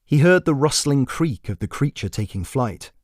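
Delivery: usual speaking voice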